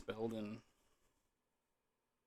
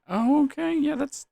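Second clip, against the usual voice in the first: surprised; 5 dB louder than usual. Real, not acted. Stats more than double.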